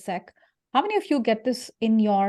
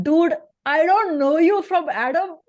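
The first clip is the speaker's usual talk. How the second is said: put-on Indian accent, higher, louder and slow, fed up; pissed Indian accent; 7 semitones higher, 7 dB louder, slower than usual. Annoyed at a LinkedIn stranger, scolding him in an Indian accent. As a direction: snap back in an Indian accent like a stranger spammed you. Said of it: "my Indian accent comes out when I get pissed".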